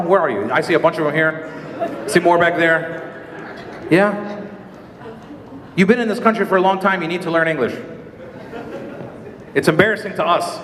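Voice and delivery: ordinary talk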